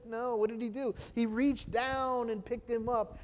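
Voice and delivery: ordinary delivery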